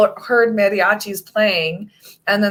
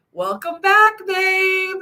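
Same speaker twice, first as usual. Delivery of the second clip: mock greeting; 11 semitones higher, much slower than usual. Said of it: "he goes".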